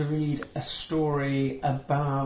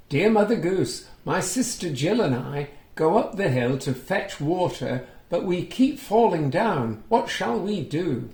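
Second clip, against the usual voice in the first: lively, sing-song storybook reading, a little higher and louder; reading to children; 5 semitones higher, 3 dB louder than usual. A narrator's reading. Reading a picture book aloud to young children, lively storytelling. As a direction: bring the story alive for little listeners. Reads Jack's letter.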